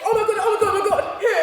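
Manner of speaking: breathless eager gossip; excited squeal. Retold or imitated. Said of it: "You say".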